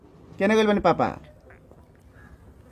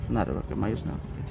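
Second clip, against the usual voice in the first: soothing comfort; 7 semitones lower, 10 dB quieter than usual. Real, not acted. Reporter comforts crying girl.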